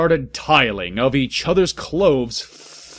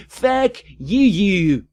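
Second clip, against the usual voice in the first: mock outburst; 10 semitones higher, 5 dB louder, slower than usual. Mocking flamers in author note.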